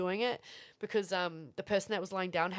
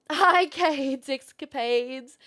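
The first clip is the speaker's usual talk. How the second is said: slow, high, stretched-out hello; greeting a chatter slowly and high; drawn-out greeting; 6 semitones higher, 9 dB louder, much slower than usual. Real, not acted. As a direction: draw out the name playfully. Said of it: "greeting a chatter".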